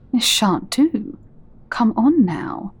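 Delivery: usual speaking voice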